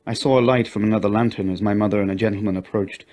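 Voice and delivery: ordinary delivery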